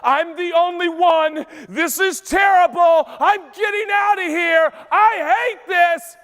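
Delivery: high-pitched, overwrought complaint; hammed-up despair, last words stretched; swelling drama. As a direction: wail it like a mock meltdown, building to the end. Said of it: "The emotions begin to swell".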